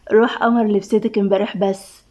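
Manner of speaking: plain reading voice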